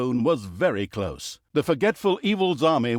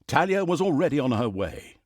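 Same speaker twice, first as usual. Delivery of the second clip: usual speaking voice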